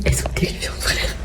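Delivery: unvoiced scared whisper. Real, not acted. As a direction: whisper so no one hears. Whispering in the gym toilets. (chuchoté)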